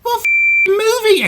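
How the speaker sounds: very high, loud furious shriek. A narrator's reading. Small engine orders Henry to move, shrieking. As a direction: screech the order, out of patience. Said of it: "Percy shrieked".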